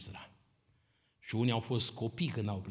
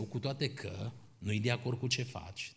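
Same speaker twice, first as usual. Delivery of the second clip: ordinary talk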